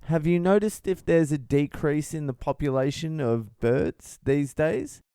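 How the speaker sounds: a hint of a sweet old lady. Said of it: "and then she goes".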